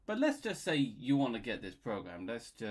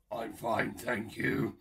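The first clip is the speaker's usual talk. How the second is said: train's reply; 6 dB louder, slower than usual. Acted. Train answers the greeting.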